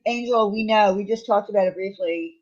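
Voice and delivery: ordinary delivery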